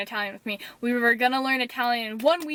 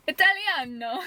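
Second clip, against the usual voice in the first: fake Italian; 4 semitones higher than usual. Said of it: "me being fake Italian".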